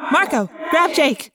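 high, slow firm order; commanding. A narrator's reading. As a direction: give the order firmly. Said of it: "Rachel ordered".

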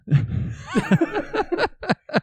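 squealing laugh; high giggling outburst; high burst of laughter. Real, not acted. Laughs at the story's end.

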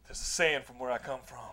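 low, grave movie-hero voice; deep solemn pronouncement; solemn warrior. Retold or imitated. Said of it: "And he's like".

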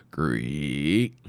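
low creaking sound effect. A narrator's reading. Narrator voicing a slow creak. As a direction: voice a creaking grave marker.

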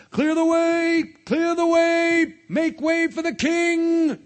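high, drawn-out shout clearing the road; heralding shout. Acted. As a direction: call out loudly like a royal herald. Guards clear street for king.

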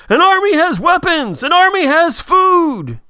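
high, loud battle-speech cry; Peter DeLuise's shout. Retold or imitated. Co-host quoting an off-screen voice from the episode, high rallying shout. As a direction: call it out high, stretching the last word. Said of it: "you hear somebody says".

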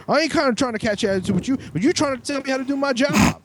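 high, rapid imitation of an offended worker; defensive mock protest. Worker argues back at the man correcting him, defensive. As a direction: push it out fast and high, taking offence.

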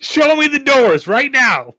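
loud, high, drawn-out demand; hammed-up outraged yell; mock demanding shout. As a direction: holler it slowly, high and loud. Joking acted demand for doors.

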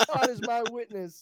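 loud, high Southern belle declaration; Scarlett O'Hara. Acted. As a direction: proclaim it like an old film heroine. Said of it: "Scarlett O'Hara".

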